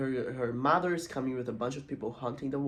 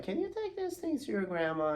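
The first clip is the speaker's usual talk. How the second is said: higher, mock motherly request; a hint of a higher-pitched mom; lightly voiced mom; 10 semitones higher than usual. Mom sends daughter to grandma, lightly voiced higher.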